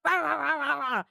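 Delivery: hammed-up, high German rant; mock German shouting. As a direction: bark like a caricature German. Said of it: "assumption that everyone in Germany was like".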